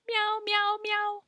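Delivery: high squeaky kitty meow; cat meow. Acted. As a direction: mew like a little cat, way up high. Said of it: "And the gata says".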